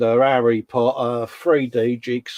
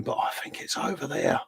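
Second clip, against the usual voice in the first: whispered; 6 semitones higher, 10 dB quieter than usual. Pretend. Voicing a soldier in the model.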